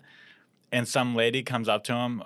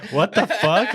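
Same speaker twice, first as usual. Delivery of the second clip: high, laughing disbelief; incredulous laughing; 8 semitones higher, 4 dB louder, slower than usual. Retold or imitated. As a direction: react in stunned disbelief. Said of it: "everyone was just like".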